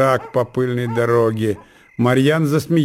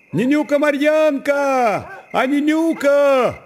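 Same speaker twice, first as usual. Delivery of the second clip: little brother calling. A narrator's reading. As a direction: call out like a small boy, stretching the names. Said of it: "прокричал, подбегая к казачкам, маленький брат Марьяны".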